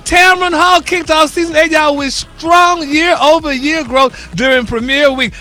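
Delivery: high-energy, emphatic gossip news delivery; entertainment news read. Real, not acted. Radio host delivering celebrity news to the morning crew, high-energy news read.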